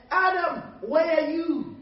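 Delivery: loud, high call as God to Adam. Acted. Preacher as God searching for Adam, calling out loudly. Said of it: "called out to Adam".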